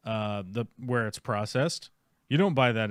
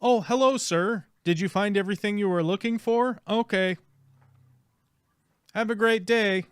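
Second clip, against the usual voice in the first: high, bright customer-service voice; sing-song fake politeness to a customer; chirpy store clerk; 9 semitones higher, 3 dB louder than usual. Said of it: "they say things like".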